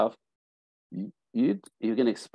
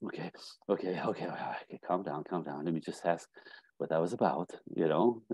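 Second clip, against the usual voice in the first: calming herself; 5 semitones lower, 9 dB quieter than usual. Retold or imitated. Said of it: "So she's like".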